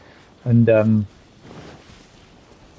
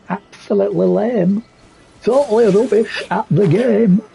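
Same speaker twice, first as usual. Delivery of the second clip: higher, slow put-on Cheshire accent; mock Cheshire accent; 8 semitones higher than usual. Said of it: "I don't know what accent that is".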